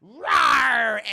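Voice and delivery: loud, high mock roar; imitated monster roar. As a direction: roar like a creature revealing itself. And then she goes.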